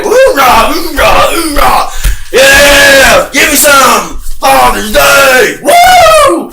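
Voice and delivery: high, loud sarcastic whooping; mock cheer. Son mockingly celebrating Father's Day, hammed-up whooping. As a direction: cheer like a drunk at a party, mocking.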